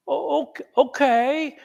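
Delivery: high, stammered, uncertain reply; hesitant okay. Retold or imitated. Her to the trademark examiner on phone, hesitant. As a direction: act out a wary, halting agreement.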